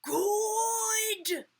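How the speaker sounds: high, loud, stretched-out cheer; long storytime holler; children in unison. A narrator's reading. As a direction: call it out like a whole class. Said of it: "all said in unison".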